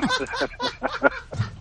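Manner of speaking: burst of laughter. Real, not acted. Caller laughs at his mistake.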